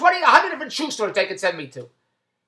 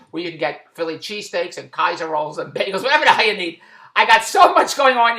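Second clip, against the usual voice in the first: usual speaking voice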